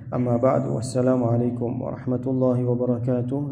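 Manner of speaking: rapid, even recitation of Arabic blessings; brisk monotone liturgical delivery; formal opening invocation. Real, not acted. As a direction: run smoothly through the familiar opening praises.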